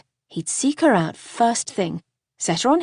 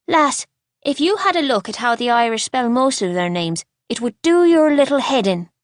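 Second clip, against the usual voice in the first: put-on scoffing Irish boy, growing louder; thick Irish accent; 3 semitones higher, 4 dB louder than usual. A narrator's reading. Boy mocks the girl about Irish names, scoffing Irish accent. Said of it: "thick Irish accent of his".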